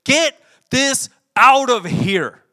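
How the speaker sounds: angry shout, slow and high; Jesus. Retold or imitated. Jesus whipping tables in the temple, angry shout. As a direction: shout it furiously, one forceful beat at a time. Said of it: "He was so angry one time".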